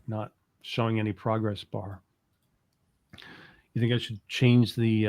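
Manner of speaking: ordinary talk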